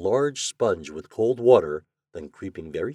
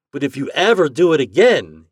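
loud, raised-pitch warning; threatening; 6 semitones higher, 10 dB louder than usual. A narrator's reading. Chuck warning Toad about the prank, threatening. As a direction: warn him forcefully. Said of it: "he threatened".

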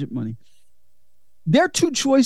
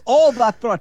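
put-on Jamaican accent; 3 semitones higher than usual. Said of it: "I do love the Jamaican accents".

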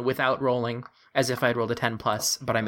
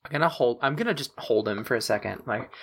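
usual speaking voice

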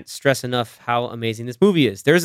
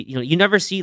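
normal talking voice

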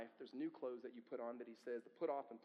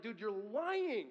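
slow, louder, half-mocking dude you're lying; mock accusation; 6 semitones higher, 6 dB louder, slower than usual. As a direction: drag it out like a dad calling a bluff. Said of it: "'Cause you're like".

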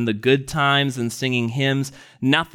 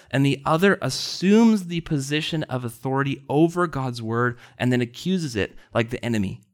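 usual speaking voice

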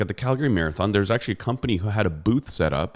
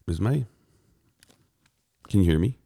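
ordinary delivery

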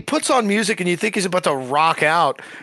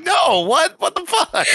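mock outrage; 13 semitones higher, slower than usual. Reacting as Peacemaker, laughing.

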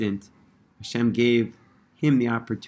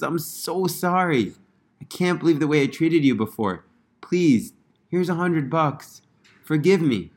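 higher, eager, rushing to make amends; bubbling over with relief; thrilled apology; 7 semitones higher than usual. Retold or imitated. Rabbi finally finds the beggar and apologizes, thrilled. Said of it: "he's so happy. He's thrilled".

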